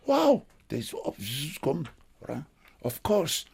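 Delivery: excited. Retold or imitated. Star-struck driver reacts to Pelé.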